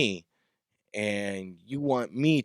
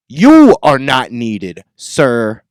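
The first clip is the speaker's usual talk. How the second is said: slow, loud, emphatic put-down; angry dismissal; 13 dB louder, much slower than usual. Real, not acted. Musician dismissing promoters, angry and emphatic. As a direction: lean loud on "You", then drop.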